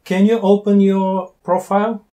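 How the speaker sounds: normal talking voice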